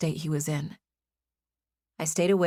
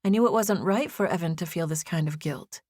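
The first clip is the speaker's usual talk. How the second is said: ordinary delivery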